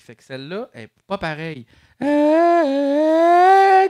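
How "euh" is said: pitch corrected. Shown as a demonstration. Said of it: "c'est pitch correct, mais chromatique".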